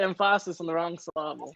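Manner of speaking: emphasis as em-PHA-sis and syllable as syl-LAH-ble; Mike Myers bit. Retold or imitated. Joking with co-host about emphasis, em-PHA-sis on the wrong syl-LAH-ble. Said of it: "we're doing Mike Myers".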